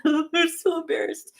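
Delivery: real high, embarrassed giggle; embarrassed laughing. Real, not acted. Streamer owns up to a flag mix-up, embarrassed laughing. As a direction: laugh through it, pitch jumping up. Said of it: "Oh, I'm so embarrassed".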